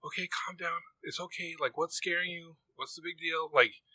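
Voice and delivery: soft, gentle reassurance; calm the scared child down softly; soothing dad. Retold or imitated. Dad soothing his scared daughter, softly reassuring. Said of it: "And I'm like".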